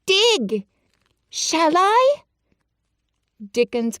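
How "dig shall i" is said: put-on high, eager boy's voice; a sickly boy daring to hope; eager Colin. Colin asks the friends, high and eager.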